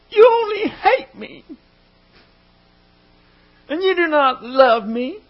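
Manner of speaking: preacher voicing a wife's crocodile tears; mock weeping wife. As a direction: ham up a tearful, accusing wife. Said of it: "I can't do it the way that she could".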